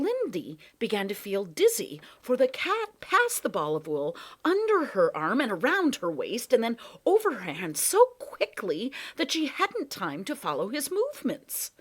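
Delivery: plain reading voice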